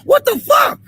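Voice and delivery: sudden very high outraged yell. Real, not acted. To the police over the blocked street, outraged high yell. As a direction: disbelief erupting into a yell. Angry confrontation with police officer.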